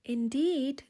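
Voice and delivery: ordinary delivery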